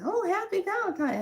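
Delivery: squeaky imitation of the post office guy; imitating the clerk. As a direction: toss off his cheery hello, pitched high. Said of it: "the guy said".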